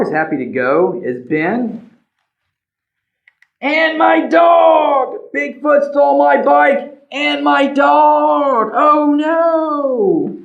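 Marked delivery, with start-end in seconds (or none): 3.6-10.4 much higher, louder, slow wail; boy howling as his dog leaves too; despairing shout. Acted. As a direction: cry out as everything is taken. Bigfoot takes bike and dog.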